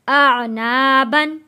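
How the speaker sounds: very slow, with "ना" drawn out; stretched recitation. Shown as a demonstration. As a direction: draw both syllables out long. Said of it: "गुन्ना नहीं किया". ("आ ना" बहुत खींचकर)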